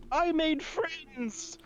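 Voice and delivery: high, slow wail; tearful pride. Acted. Aurora gesturing at Lore, tearfully proud. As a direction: stretch it out in blubbering joy. Tearful character showing off friends.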